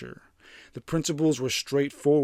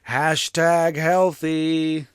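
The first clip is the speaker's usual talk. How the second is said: slow, raised put-on voice, higher and louder; mock influencer voice; 4 semitones higher, 7 dB louder, much slower than usual. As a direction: drawl it like a smug wellness influencer. Mocking cold-plunge enthusiasts.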